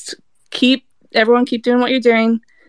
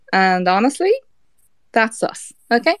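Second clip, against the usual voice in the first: ordinary talk, nothing marked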